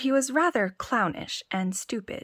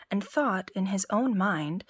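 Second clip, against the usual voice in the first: plain reading voice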